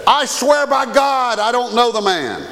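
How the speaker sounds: high, forceful swearing of innocence; sworn denial. Acted. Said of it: "this time with an oath".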